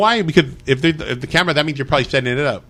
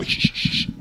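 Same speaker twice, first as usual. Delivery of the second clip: soft unvoiced shushing; hushing; 4 dB quieter, slower than usual. Real, not acted. Telling friends to hush before the ghost story, a whispered shush. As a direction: hush the room so the story can go on.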